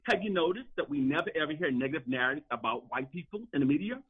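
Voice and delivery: ordinary delivery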